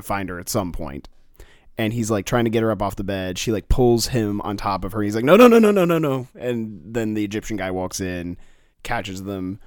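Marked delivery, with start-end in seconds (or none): 5.2-6.3 high, loud burst of refusals; panicked protest. Acted. Man pulled onto a drunk woman, mock panicked protest. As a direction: rattle it out in mock alarm, easing off.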